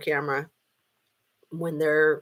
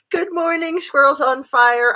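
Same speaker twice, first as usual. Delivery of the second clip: bright greeting; 7 semitones higher, 8 dB louder than usual. Real, not acted. Greeting a live chatter.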